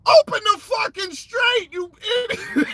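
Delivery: high, loud mocking yell. Mocking Trump yelling at Iran, high loud yell. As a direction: bark it like an angry president. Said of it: "he yells out".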